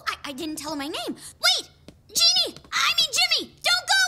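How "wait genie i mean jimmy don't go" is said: loud, high shouts after someone leaving; urgent high yell; desperate calling out. Acted. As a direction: shout after him to stop. Calling after departing genie.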